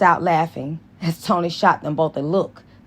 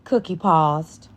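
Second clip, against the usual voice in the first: ordinary delivery, nothing marked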